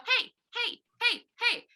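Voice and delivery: high, needling "Hey, hey" over and over; little brother pestering for attention; pestering little sibling. Pretend. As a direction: nag like a little kid tugging at your sleeve. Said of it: "They may go".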